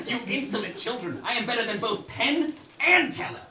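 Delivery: evil queen. Acted. Villain scolds the players.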